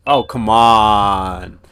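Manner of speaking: loud, drawn-out exasperation; real, long exasperated complaint; exasperated protest. Real, not acted. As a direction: groan it out loudly in protest. Balks at more reading.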